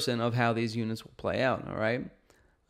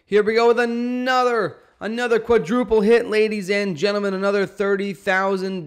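excited hype; 7 semitones higher, 6 dB louder than usual. Real, not acted. Hyped replay of quadruple hit.

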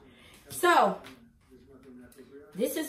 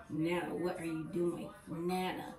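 embarrassed grandkid; 5 semitones lower, 7 dB quieter than usual. Retold or imitated. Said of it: "I know y'all embarrassed now".

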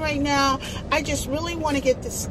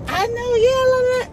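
breezy sing-song fake chatter, much higher and louder; fake phone chatter; 12 semitones higher, 5 dB louder than usual. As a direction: act busy on the phone to dodge them. Pretending to be on the phone.

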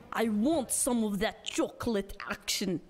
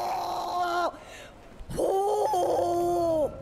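hammed-up shock; 8 semitones higher, much slower than usual. Man crying out in mock horror at his wife.

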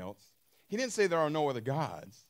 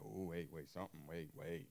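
mock realization; 10 semitones lower, 14 dB quieter than usual. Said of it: "You're like".

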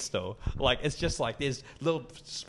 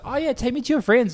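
high, louder imitated voice; eager woman; 11 semitones higher, 6 dB louder than usual. Woman inviting herself to his friends, high eager imitation. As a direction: pipe up keenly, mimicking her. Mimics the woman propositioning him.

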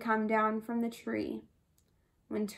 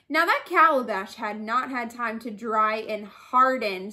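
usual speaking voice

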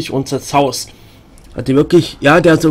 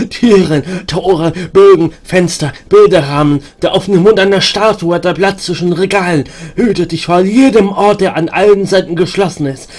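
a slightly higher, urgent warning voice; warning madwoman; 4 semitones higher, 4 dB louder than usual. Acted. Madwoman warning the stranger about doors, lightly higher. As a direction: lean in and warn him, half-crazed.

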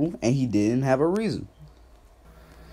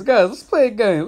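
higher, louder, hyped announcement; rally the chat for a game; excited call; 6 semitones higher, 4 dB louder than usual. Real, not acted. Calling the stream chat into a game, hyped.

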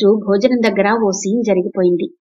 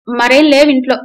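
sulky; 4 semitones higher, 6 dB louder than usual. A narrator's reading. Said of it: "Iyer మొహం ముటముటలాడించుకుంటూ అన్నాడు".

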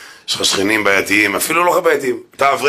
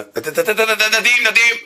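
higher, louder stammered curse; mock cursing; 10 semitones higher, 3 dB louder, slower than usual. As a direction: spit out the curse like a furious yeshiva boy. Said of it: "בחור ישיבה מקלל". (קללה מבוימת בקול גבוה ורם)

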